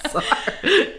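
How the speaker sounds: slow, amused repeat through laughter; laughing. Real, not acted. Repeating the Home Alone kid line, laughing. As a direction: savour the phrase while laughing.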